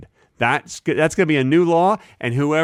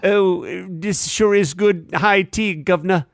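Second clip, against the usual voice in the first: slow, higher mock British voice; British accent; 4 semitones higher, slower than usual. Imagined high tea guest in L A, mock British accent. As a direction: ham up a bad British accent. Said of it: "speaking in a British accent going".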